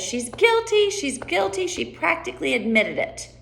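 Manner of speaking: high-pitched outburst, settling on the last words; rabbit accusing. Acted. Rabbit accusing Alice at the trial, urgent high accusation. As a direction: accuse her insistently, starting high.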